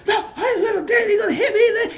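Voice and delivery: fast, very high squealing imitation; mock hysterical outburst, shrieked high and quick; all hype. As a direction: imitate someone frantically worked up. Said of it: "Prince got figure hype".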